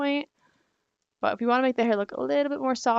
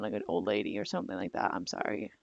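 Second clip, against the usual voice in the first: much lower, voice cracking down to a whisper; losing her voice; 11 semitones lower, 6 dB quieter than usual. Real, not acted. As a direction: let the tired voice sink to almost nothing. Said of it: "I think I'm losing my voice".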